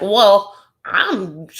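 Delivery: a touch of Southern drawl, loud start; Southern accent. Retold or imitated. Podcaster starts voicing an insulted Jenny, light Southern accent. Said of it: "I'm giving her Southern accent".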